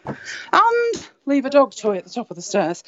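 normal talking voice

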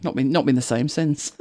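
ordinary talk

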